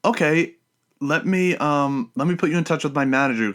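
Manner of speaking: high-pitched imitation of a woman caller; lightly mimicked female telemarketer. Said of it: "She was like".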